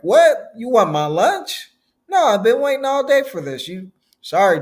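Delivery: loud, high-pitched indignant retort; boy with attitude. Retold or imitated. Boy refusing Jesus his lunch, mock indignant. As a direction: act out a kid copping an attitude. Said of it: "had an attitude like".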